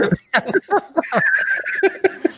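breathless silent laugh; unvoiced wheeze of laughter; wheezing laugh. Real, not acted. Teased for revealing answer late.

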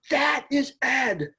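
high, slow proclaiming; excited declaration. Retold or imitated. Director declares the voice found, slow and high. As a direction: announce the discovery with awe. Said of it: "he's like".

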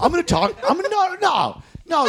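high, laughing stage bluster; hammed-up comic refusing to stop; mock comic on stage. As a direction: hold the floor against hecklers.

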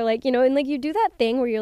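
usual speaking voice

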